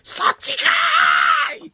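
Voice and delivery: high, drawn-out yell, slow; mock tsukkomi shout. Retelling Oda's punchline to cohost, slow high shout. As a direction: stretch the retort into a huge shout.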